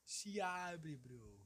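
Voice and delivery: lightly voiced catchphrase; a hint of the streamer's bro; imitating João Frango. Attempting the catchphrase again, lightly voiced. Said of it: "imitar o João Frango".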